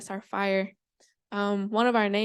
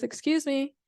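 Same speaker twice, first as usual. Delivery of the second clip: small, higher-pitched voice; little voice; 5 semitones higher than usual. Acted. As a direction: pipe up politely as a tiny creature. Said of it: "this little voice speaks up".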